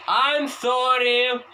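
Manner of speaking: high, slow, loud I'm sorry; loud drawn-out apology. Shown as a demonstration. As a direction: belt out a long, stretched apology. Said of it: "Я ещё повторю".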